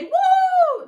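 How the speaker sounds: high, loud whoop; cheering. Acted. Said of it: "The gentlemen cheered".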